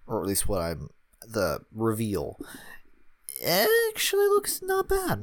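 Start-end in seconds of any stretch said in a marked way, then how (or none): 3.4-5.2 high squeak of surprise; surprised. Real, not acted. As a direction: pitch jumps way up, impressed. Surprised by picture.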